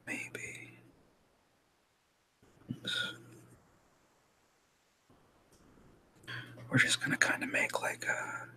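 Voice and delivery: soft whispering to self; leaning in to whisper. Real, not acted. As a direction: whisper it as if talking to yourself. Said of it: "I'm just gonna go to a whisper voice now".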